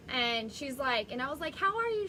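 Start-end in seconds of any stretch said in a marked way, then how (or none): 1.5-2.1 much higher, louder, bubbly hello; chirpy greeting. Retold or imitated. Smiling at an upset neighbor in Costco, chirpy. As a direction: imitate your own sunny greeting, pitched way up.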